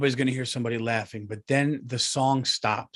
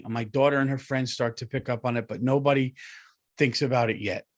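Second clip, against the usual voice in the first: ordinary delivery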